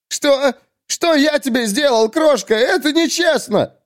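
high, panicked protest; aggrieved poet. Acted. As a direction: wail that you are being wronged. (обиженный вопль высоким голосом)

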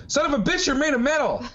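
high, startled outburst; freaked out. Retold or imitated. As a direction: shriek it in shock. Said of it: "She would have been like".

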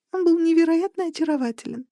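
usual speaking voice

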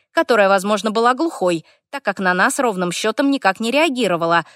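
plain reading voice

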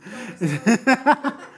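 real laugh at the phrase; short amused laugh; chuckling at the slang. Real, not acted. Laughs at the expression.

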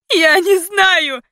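high, loud, drawn-out cry of despair; desperate scream. A narrator's reading. Heroine begging captors to believe her, desperate scream. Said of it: "кричала я в отчаянии".

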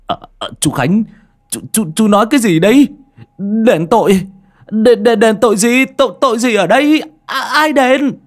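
high, loud, panicked stammering; stunned surprise. A narrator's reading. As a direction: blurt startled questions, voice shooting way up. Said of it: "Ông Chiến ngạc nhiên".

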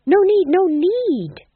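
high, loud, drawn-out desperate plea; urgently head them off. A narrator's reading. Fool trying to stop the executions, desperate. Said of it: "said the fool desperately".

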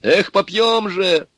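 high, whooping anticipation; joyful shouting. A narrator's reading. Guards look forward to revelry, shouting happily. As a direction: whoop at the coming feast. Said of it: "закричали они".

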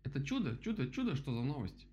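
high-pitched excited patter; chattering. A narrator's reading. Woodpecker welcomes the news, chattering. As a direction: rattle it off in a high voice. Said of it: "затараторил дятел".

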